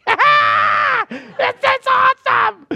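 bursting with glee at the dancers; excited shout. Retold or imitated. At the dance show to his wife, loud gleeful shout. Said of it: "And I'm like".

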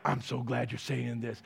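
preacher voicing a grateful listener, low and soft; imitating a pleased listener. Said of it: "Some are like".

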